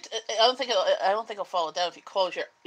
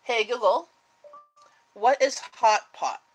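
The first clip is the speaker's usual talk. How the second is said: commanding voice assistant; 4 semitones higher, 6 dB louder, slower than usual. Acted. Talking to voice assistant.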